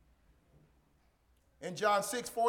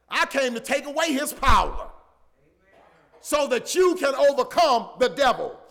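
rising, louder and faster on the devil, then pounding; full-force preaching; 6 semitones higher, 9 dB louder than usual. Acted. Preacher to his congregation, building to a loud climax. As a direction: build the sermon to a roar.

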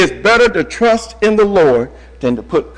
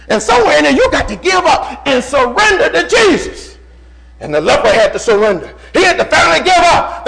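fiery preaching; 8 semitones higher than usual. Acted. Preacher pressing the flock to surrender, in fiery preaching. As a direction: preach it out, rising with fire. Preacher building to full force.

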